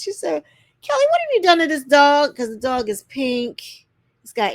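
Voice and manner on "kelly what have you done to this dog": loud, high-pitched appalled question; imitated shock. Guest questioning Kelly about the pink dog, mock appalled outburst. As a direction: mimic her appalled reaction, louder and higher.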